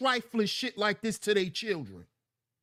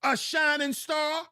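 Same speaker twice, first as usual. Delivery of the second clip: higher, slow mocking disbelief; incredulous repeat; 6 semitones higher than usual. Real, not acted. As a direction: repeat it higher as if it were absurd.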